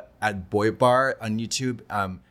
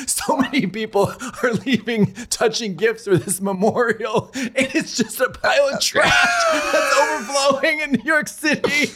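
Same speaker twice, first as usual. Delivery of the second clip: high, rising laughing shout; laughing impression; 12 semitones higher than usual. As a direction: crack up while quoting her, climbing high. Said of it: "she was like".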